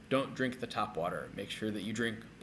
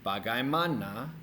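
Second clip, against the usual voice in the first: bagaimana said quicker, rising at the end; quicker pronunciation. Shown as a demonstration. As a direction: run the syllables of bagaimana together. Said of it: "If you say it quicker".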